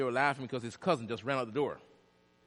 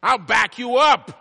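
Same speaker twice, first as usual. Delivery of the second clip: high, slow, booming promise; loud proclamation; 10 semitones higher, 15 dB louder, slower than usual. Acted. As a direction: shout it out as a preacher voicing God. Proclaims promise loudly, then repeats softly.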